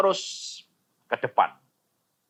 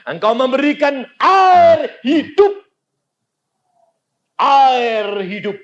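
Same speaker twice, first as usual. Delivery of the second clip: loud, ringing preacher's shout, higher pitched; full-force preaching; 9 dB louder than usual. Acted. Preacher urging the congregation to pray, loud preaching. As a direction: thunder it out to the congregation.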